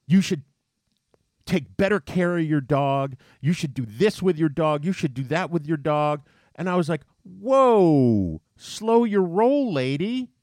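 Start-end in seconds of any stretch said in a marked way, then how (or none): 0.1-6.2 raised, louder scolding voice; imitating a lecturing stranger at full volume; woman yelling. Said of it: "yelling at me". 7.2-10.3 high, slow, drawn-out exasperated whoa; indignant retort, much higher and slowed right down; pushing back. Retold or imitated. Retort to the yelling woman.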